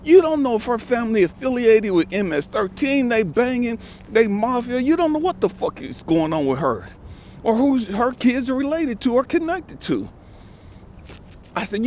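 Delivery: ordinary talk, nothing marked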